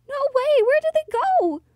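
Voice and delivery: high, loud cry of alarm; acted panic. Heroine finding her friends gone, acted panic.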